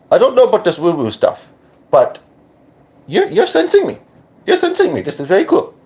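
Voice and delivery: put-on high-pitched accent verging on British-Australian. Voicing his reaction to being sensed, put-on accent. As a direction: jump right up high in a borrowed accent. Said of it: "And again, sorry for the accent".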